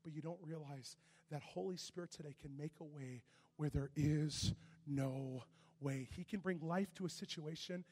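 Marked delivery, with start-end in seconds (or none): 3.7-6.1 there is no way drawn out slowly; slowed refrain. Real, not acted. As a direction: stretch every word of no way.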